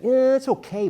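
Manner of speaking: hammed-up lukewarm approval; noncommittal verdict. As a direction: stretch a high "yeah" as if only half convinced. Acting a grudging reaction to a title.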